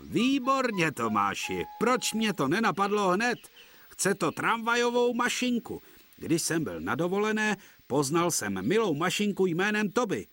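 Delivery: a hint of a cheerful boss with a bright idea; delighted idea. Fat controller tells Thomas his plan, lightly excited.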